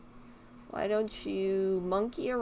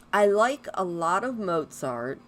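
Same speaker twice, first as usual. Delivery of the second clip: normal talking voice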